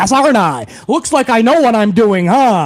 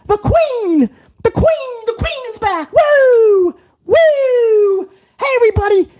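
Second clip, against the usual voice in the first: high squealing fan cheering, much higher; mocking excited fan; 8 semitones higher, slower than usual. Mocking online fans cheering that the queen is back. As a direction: ham up a giddy fangirl shouting woo. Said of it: "They'll be online with a hundred and forty characters".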